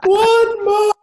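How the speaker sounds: high, loud mock scream of a ten-year-old; child's shriek, imitated at full volume; screaming kid. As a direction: yell it like a kid screaming down the line. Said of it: "ten-year-old kid scream down the line".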